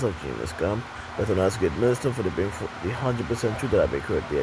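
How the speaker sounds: low, measured benediction voice; solemn closing blessing. Acted. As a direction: intone the closing greeting gravely.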